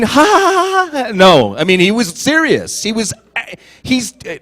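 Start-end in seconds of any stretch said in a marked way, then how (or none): 0.0-1.1 high, spelled-out ha ha ha; mock laugh. Guest laughs off the awkward joke, high and hammed-up. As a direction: laugh it off theatrically.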